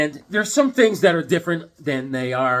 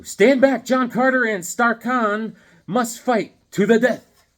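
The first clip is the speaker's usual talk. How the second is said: loud, higher, slow declaiming; dramatic announcer; 6 semitones higher, 6 dB louder than usual. Acted. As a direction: boom it like a comic blurb. Reading cover blurb dramatically.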